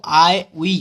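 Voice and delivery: आईवी slowly as two words, "I, we"; pun pronunciation. Shown as a demonstration. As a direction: split आईवी into a drawn-out "आई ... वी". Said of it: "आईवी को हम आईवी बोल रहे हैं".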